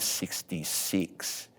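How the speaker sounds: normal talking voice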